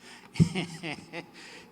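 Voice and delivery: short unvoiced chuckle; sheepish laughter; breathy laugh. Real, not acted. Laughing at his own foolishness.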